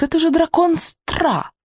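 high, slow, pleading cry; urgent protest. Acted. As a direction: insist it matters. Boy protesting to witch.

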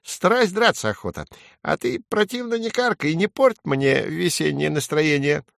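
high little sparrow voice; feisty sparrow. A narrator's reading. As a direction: talk back as a plucky small bird. Sparrow talks back to crow.

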